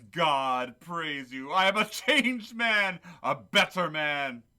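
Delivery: slow, high, loud proclamation of joy; overjoyed declaration. Acted. Scrooge tells Jacob he is a changed man, slow, high and loud with joy. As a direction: declare it slowly and loudly, full of wonder.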